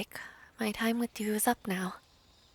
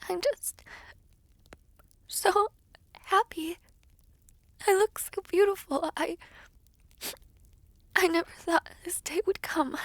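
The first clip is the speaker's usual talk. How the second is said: much higher, teary and breaking; happy tears; 9 semitones higher than usual. Acted. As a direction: sob out the joy, voice wavering up high. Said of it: "Why are you crying?".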